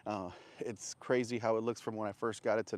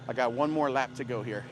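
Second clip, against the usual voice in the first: ordinary talk, nothing marked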